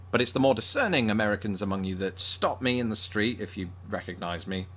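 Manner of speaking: normal talking voice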